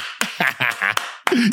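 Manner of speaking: amused burst of laughter; high, breathy chuckling. Real, not acted. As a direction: break into a laugh. Laughs at own hyped-up yeah.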